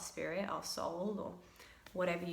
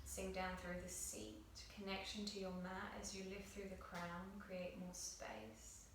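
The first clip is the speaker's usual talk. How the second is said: soft, hushed meditation guidance; guided meditation; 15 dB quieter than usual. Acted. Yoga teacher guiding students through breath on their mats, soft meditative voice. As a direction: guide the class gently into breath awareness.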